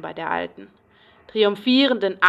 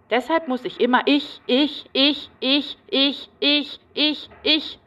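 each ich pulled out higher; repeated insistent ich; 5 semitones higher, slower than usual. Acted. Patient insists on herself, ich ich ich higher. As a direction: hammer on ich again and again.

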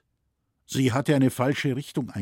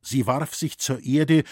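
usual speaking voice

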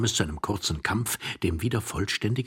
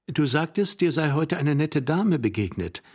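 high, clear questioning wife; young wife; 9 semitones higher, 5 dB louder than usual. Acted. Young wife reminding her forgetful husband, high-pitched. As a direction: prompt him in a raised, higher voice. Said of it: "fragte die junge Frau".

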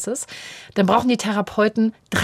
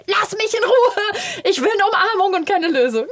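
whiny protest; 11 semitones higher than usual. Retold or imitated. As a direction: plead for a hug like a worn-out child. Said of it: "Und ich sage".

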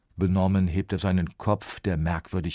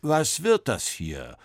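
slow, louder booming question; 8 semitones higher, 5 dB louder, slower than usual. A narrator's reading. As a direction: boom it from above. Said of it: "dröhnt plötzlich eine Stimme".

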